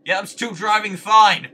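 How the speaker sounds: loud, high gloating yell; triumphant shout. Real, not acted. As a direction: shout it out proudly at the screen. Gloating at the wheel.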